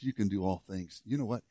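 soft, gentle encouragement; Paul softly telling you that you are good enough; Paul reassuring. Acted. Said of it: "Paul saunters up beside you and says".

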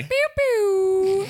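high squeaky pew pew horn; air horn noise. Pretend. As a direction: toot it like a tiny air horn. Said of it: "I can't do the air horn noise".

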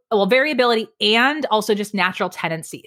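normal talking voice

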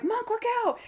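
high-pitched imitation of calling out; retold warning cry.

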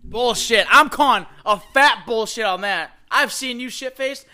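loud, slow, teasing bullshit call; loud callout. Real, not acted. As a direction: call her bluff loudly and slowly. Calls out a friend's claim.

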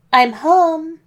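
loud, high call into the house; announcing arrival. A narrator's reading. Wolf opening his front door, announcing loudly. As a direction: call out that you're back.

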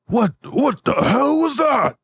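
very high, slow groaning daze; dazed dwarf. Acted. Beaten dwarf reels out the door, high and groaning. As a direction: reel from the beating. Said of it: "he's like".

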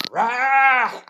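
loud, high short roar; big mock animal roar; playful roar. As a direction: roar out for the children.

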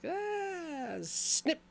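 high, gleeful mock Freddy; gleeful villain. Freddy cutting the puppet strings, high and gleeful. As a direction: relish the snip like a villain. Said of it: "he's just like".

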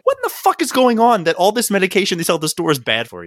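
mock outraged rant; incredulous outburst. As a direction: blurt it out in shocked disbelief. Exasperated retold outburst.

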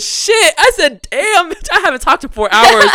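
high, loud blurted cursing; loud outburst. Retold or imitated. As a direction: explode after hours of silence. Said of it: "My voice was ready to pop".

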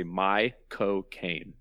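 a light British accent on My cocaine, slow and drawn out; Michael Caine accent. Shown as a demonstration. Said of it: "the best way to say Michael Caine in a Michael Caine accent".

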